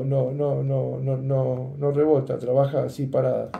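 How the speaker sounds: ordinary delivery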